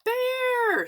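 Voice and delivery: very high, loud alarmed shout. A narrator's reading. Townsperson spotting the bear, alarmed shout. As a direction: yell the warning at full voice. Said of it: "somebody shouted".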